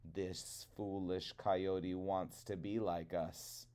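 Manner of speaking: low, soft scheming crow voice; low sly old crow; Old Man Crow. Acted. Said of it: "Old Man Crow said to his flock".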